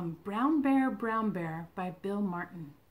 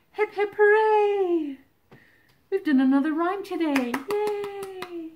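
excited cheer; 8 semitones higher, 9 dB louder than usual. Real, not acted. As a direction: cheer it out high and proud. Cheering at end of the book.

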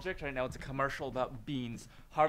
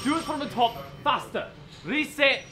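impatient command; 8 semitones higher, 6 dB louder than usual. Acted. Angry director demands faster take.

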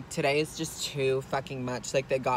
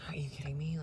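breathless unvoiced whisper, much softer; whispered disbelief; 11 dB quieter than usual. Fed-up driver muttering to herself after the near crash, whispered. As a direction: hiss it under your breath, done with everything. Exhausted rant aside.

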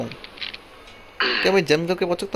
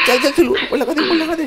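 much higher, louder frantic urging; excited urging; 14 semitones higher, 4 dB louder than usual. Real, not acted. Urging his partner up the climb in a high excited yell. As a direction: push him on, yelling high.

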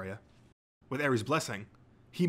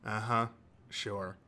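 deflecting; slower than usual. A narrator's reading. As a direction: humour the gushing fan drily. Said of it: "deftly deflecting Midoriya's enthusiasm".

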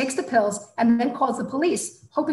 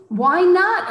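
loud, higher, frustrated plea; exasperated shout; 9 semitones higher, 8 dB louder than usual. A narrator's reading. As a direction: blurt it out fed up. Frustrated outburst.

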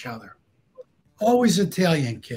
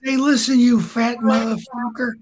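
high-pitched irate caller, retold; raised, worked-up mock holler; 6 semitones higher than usual. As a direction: imitate an angry man hollering down the phone. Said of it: "And he's hollering".

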